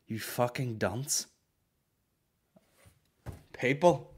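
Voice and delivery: ordinary delivery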